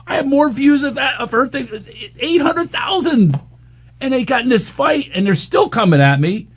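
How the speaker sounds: high, agitated ranting; worked up. Real, not acted. Dad ranting about critics of daughter, high and worked up. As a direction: voice climbing as he vents. Worked up over online fight.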